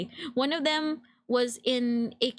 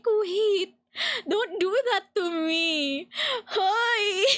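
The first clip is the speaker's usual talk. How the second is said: flustered protest; 7 semitones higher, much slower than usual. Retold or imitated. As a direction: beg them to stop, half crying.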